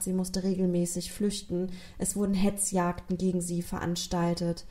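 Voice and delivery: ordinary talk, nothing marked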